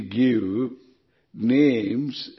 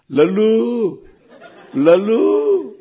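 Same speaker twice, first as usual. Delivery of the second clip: high, loud, long-drawn name call; calling a nickname; 8 semitones higher, 5 dB louder, much slower than usual. Acted. Mimics family calling Lalita by her short name, high loud calling. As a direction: holler the pet name, holding the last vowel. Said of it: "one girl was called a Lalita".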